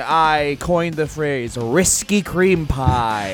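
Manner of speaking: slow, regal proclamation; slow grandiose voice. Acted. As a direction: announce it slowly like a king. Grand slow mock plug.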